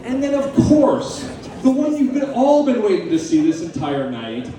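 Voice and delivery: ordinary talk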